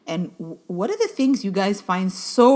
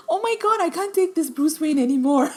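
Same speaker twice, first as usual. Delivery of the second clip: exasperated; 8 semitones higher, 3 dB louder than usual. Retold or imitated. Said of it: "I'm like".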